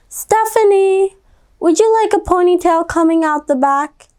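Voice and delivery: lightly voiced gentle mother; mom's voice. A narrator's reading. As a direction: ask her kindly like a mom. Mom voiced in story.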